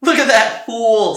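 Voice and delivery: loud, high scornful call; mocking jeer. A narrator's reading. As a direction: jeer at him like a rowdy soldier. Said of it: "laughing at him".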